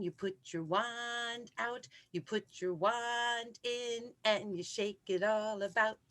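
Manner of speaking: ordinary talk, nothing marked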